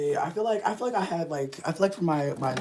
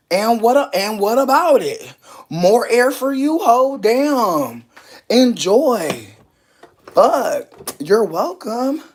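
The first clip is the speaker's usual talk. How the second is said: sassy; 6 semitones higher, 8 dB louder, much slower than usual. Real, not acted. Sassy loud high declarations.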